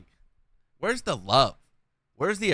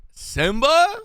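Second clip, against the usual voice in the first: high rising call of a name; high sing-song call; 12 semitones higher, 5 dB louder than usual. Real, not acted. Calling out chatter name.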